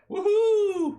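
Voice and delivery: high, loud whoop of joy; excited cheer. Real, not acted. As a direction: cheer the win loudly. Passes the dexterity roll.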